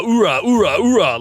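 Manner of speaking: loud gung-ho soldier. Retold or imitated. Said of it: "no one talks like this".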